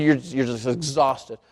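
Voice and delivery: ordinary talk, nothing marked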